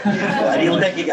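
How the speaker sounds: badly faked Brooklyn, breaking into laughter; laughing stab at Brooklyn speech; Brooklyn accent. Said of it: "I can't do the Brooklyn accent".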